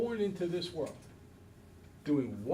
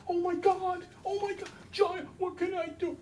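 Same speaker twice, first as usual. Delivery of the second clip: put-on high, frantic fussing over a baby; panicky adult. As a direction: play the flustered grown-up rushing to the baby.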